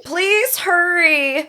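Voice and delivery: put-on high, pleading frightened girl; 911 caller. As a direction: beg for help, panicked. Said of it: "I am going to be Melissa".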